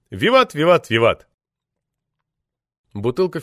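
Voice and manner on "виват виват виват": cheering toast. A narrator's reading.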